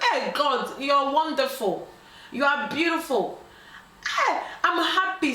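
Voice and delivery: high, hammed-up praise to God; drawn-out mock rejoicing; happy, retold. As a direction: act out jubilant, grateful relief. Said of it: "the woman was so happy".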